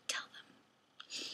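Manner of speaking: sudden hushed whisper. Real, not acted. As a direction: drop to a whisper for the last words. Urging viewers, drops to whisper.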